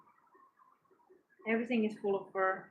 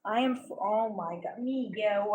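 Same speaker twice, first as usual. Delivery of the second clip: ordinary talk, nothing marked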